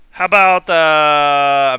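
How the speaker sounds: loud, slipping mock Russian; game master losing the Russian accent; botched accent. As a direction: stall in a failing Russian accent. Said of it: "what's going on with my accent".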